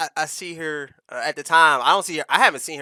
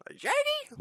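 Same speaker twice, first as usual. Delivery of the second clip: high squeaky puzzled question; high confused squeak; 4 dB quieter, much faster than usual. Retold or imitated. Retelling the girl's baffled reply, high squeak. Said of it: "the weirdest face".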